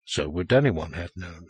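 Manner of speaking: plain reading voice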